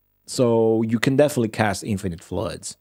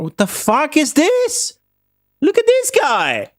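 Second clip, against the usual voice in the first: high, loud, stunned outcry; shocked outburst; 3 dB louder than usual. Real, not acted. Streamer spotting an enemy unit, high shocked outcry. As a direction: react as if a threat just appeared.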